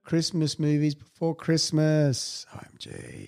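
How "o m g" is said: soft breathy whisper; whispered OMG. Real, not acted. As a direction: breathe it in disbelief. Whispered again.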